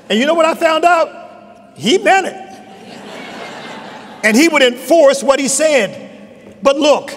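説教の盛り上がり、声を張り上げて; rising preaching. Real, not acted. Preacher builds to climax.

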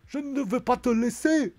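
put-on loud, high accent sliding into Russian; botched German accent. Kaiser defending Imperium's honour, botched Russian-sounding accent. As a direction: declaim indignantly in a fake German-turned-Russian accent. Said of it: "j'ai fait l'accent russe".

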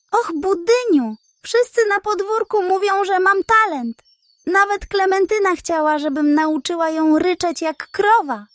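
high, proud hen voice. Acted. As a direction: preen while boasting.

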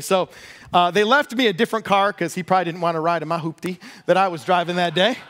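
usual speaking voice

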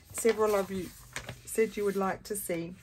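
talking through hiccups; hiccuping between words. Real, not acted. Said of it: "got the hiccups".

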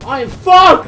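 high, very loud outburst; loud high cry of doom; panicked shout. Real, not acted. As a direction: yell it in dismay. In trouble in game.

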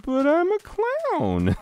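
very high, drawn-out clown wail; squealed mock protest. Clown told he will die, hammed-up squealing protest. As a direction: plead in a stretched squeaky falsetto.

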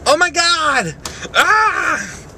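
high, loud outburst; high-pitched yelp of shock; pained yell. Real, not acted. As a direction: cry out sharply after a slap. Slaps self for views, yells.

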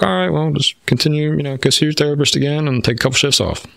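put-on high, sweet reassuring old lady voice; sweet little old lady. Counselor telling the firefighter to take shifts off, sweet and high. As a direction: soothe him like a kindly grandmother. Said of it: "sweet little old lady".

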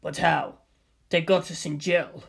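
usual speaking voice

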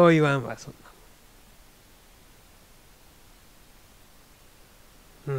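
repeated Kansai-dialect retort, loud and firm. Shown as a demonstration. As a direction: toss it off in blunt Kansai dialect. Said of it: "関西弁で言うたら、".

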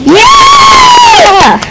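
very high, loud cheering scream; long, piercing celebratory shout; excited cheer. Acted. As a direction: shriek with team excitement. Players cheer team announcement.

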